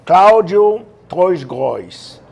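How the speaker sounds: "Cláudio Troisgros" slow and loud with every final S sounded; mispronounced name. Retold or imitated. Guest mimics how people say his name, mock mispronunciation. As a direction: imitate people saying "Cláudio Troisgros" drawn out, Brazilian-style. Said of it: "Tem gente que fala assim".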